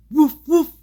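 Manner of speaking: two high, loud imitation woofs; dog bark. A narrator's reading. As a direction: bark like an excited little dog. Story's dog barks as a surprise.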